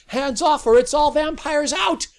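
piercing shrieked command. A narrator's reading. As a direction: shriek it at them. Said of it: "Sally herself shrieked".

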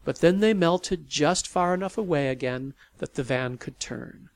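usual speaking voice

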